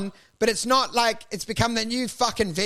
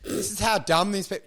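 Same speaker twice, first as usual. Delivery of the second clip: ordinary talk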